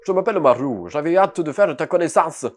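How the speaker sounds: put-on loud southern French accent. Maru meeting the newcomer, southern French accent. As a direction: introduce yourself with a southern French lilt. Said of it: "Je vais faire l'accent du sud à tout le monde".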